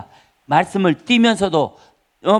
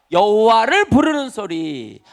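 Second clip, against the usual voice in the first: ordinary talk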